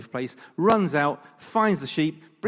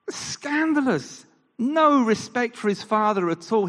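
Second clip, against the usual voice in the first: mock outrage; 9 semitones higher, 6 dB louder than usual. Shown as a demonstration. Preacher acting shocked at the older son's disrespect. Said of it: "so scandalous".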